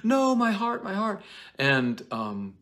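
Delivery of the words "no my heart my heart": squealing high and loud in mock horror; hysterical Juliet. Juliet shrieking at the nurse's news, hammed up. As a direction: play Juliet as a melodramatic teen. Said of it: "Juliet is like".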